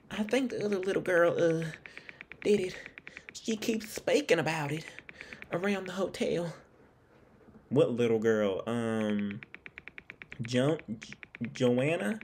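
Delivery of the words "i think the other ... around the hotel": put-on high-pitched Southern accent; Lauren. Hotel guest answering an investigator, in a high Southern accent. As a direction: voice her higher, with a put-on Southern twang. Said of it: "she sounds Southern".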